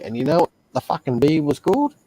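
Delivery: ordinary delivery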